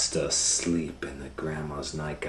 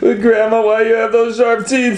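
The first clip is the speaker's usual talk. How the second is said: put-on very high, loud scared girl shout; little girl crying out; 17 dB louder than usual. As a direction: shriek the question in alarm.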